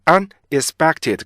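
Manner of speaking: "unexpected" slow and broken after un; heavy, stiff reading. Retold or imitated. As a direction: lean heavily on each piece of "unexpected". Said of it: "굉장히 무겁고 딱딱하고".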